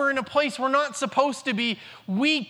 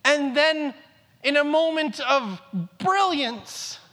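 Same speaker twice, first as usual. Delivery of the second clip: usual speaking voice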